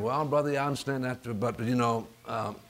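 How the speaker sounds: low, soft, hesitant churchgoer; hushed, low imitation of a sceptic; imitated doubter. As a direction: mimic a doubting brother, quiet and low. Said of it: "you might start thinking".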